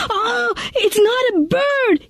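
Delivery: sudden high, slow cry of fright; frightened scream. Acted. Jason grabbing what he thought was a bird, terrified cry. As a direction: recoil in terror. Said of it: "he screamed".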